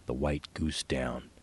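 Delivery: ordinary delivery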